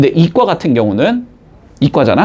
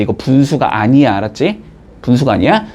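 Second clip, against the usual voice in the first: ordinary delivery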